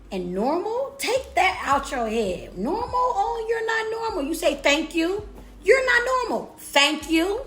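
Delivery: high, loud preacher-style hype; booming motivational speech. Acted. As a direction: boom it out like a revival preacher. Shouting affirmations to viewers.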